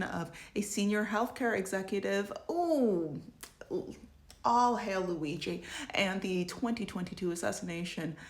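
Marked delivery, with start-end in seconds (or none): 2.5-5.6 mock reverence. Real, not acted.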